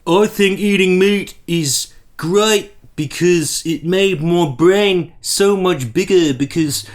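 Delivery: slow, dragged-out dumb-person voice; Bearing. Acted. Mocking a rival's case for meat, slow dim-witted voice. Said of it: "mindset of an incredibly dumb person".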